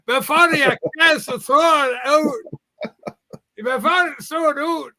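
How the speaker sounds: exaggerated comic Scottish accent. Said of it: "I think he's Scottish".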